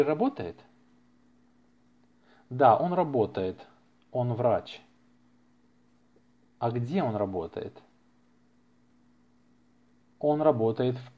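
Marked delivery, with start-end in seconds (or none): none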